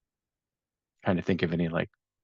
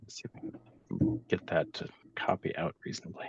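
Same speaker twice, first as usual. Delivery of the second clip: whispering; 3 semitones lower, 9 dB quieter than usual. Real, not acted. Muttering to self while working.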